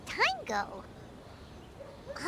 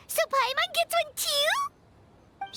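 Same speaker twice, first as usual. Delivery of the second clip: excited hope; 9 semitones higher, slower than usual. Acted. Paimon excited about dango.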